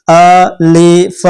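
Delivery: drawn-out अलिफ, said slowly for learners; slow demo alif. Shown as a demonstration. Teacher demonstrating अलिफ slowly for the class. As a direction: stretch अलिफ so students can copy. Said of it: "आप मेरे साथ बोल के देखो".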